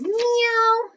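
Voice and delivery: very high, slow meow; playful kitten meow; cat meow. Shown as a demonstration. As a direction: mew like a kitten. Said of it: "You're right. Gato says".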